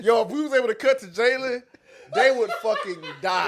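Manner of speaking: loud, high-pitched, cracked-up delivery; real laughing. Real, not acted. Co-host imagining the chat's reaction, laughing loudly. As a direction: exclaim it through real laughter.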